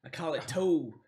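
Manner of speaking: lightly voiced dwarf menace, loud at first; threatening dwarf. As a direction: spin a tall-tale threat as a swaggering dwarf.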